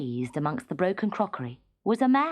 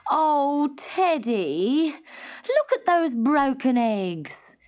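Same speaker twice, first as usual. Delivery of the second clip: higher, slow wailing mother; 7 semitones higher, 4 dB louder, much slower than usual. A narrator's reading. Mother wailing at Teddy over broken eggs, wailing. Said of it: "his mother would wail".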